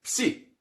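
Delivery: isolated Psi sound; psi demo. Shown as a demonstration. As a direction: give the crisp Greek Psi. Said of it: "um som de psi".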